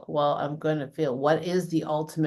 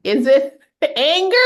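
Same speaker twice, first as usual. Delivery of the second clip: louder, higher, playful mock therapist questions; joking she should coach her husband at the dryer, higher and louder; 6 semitones higher, 7 dB louder than usual. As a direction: tease him like a life coach would. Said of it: "You should just ask him".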